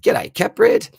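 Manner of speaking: high, loud, thrilled hello; light up to greet a favourite viewer; excited greeting. Real, not acted. Host greets a viewer by name, excited and loud.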